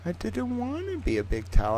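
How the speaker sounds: high, soft, put-on sad voice; mock whimper. Streamer lamenting becoming a big towel, high and soft.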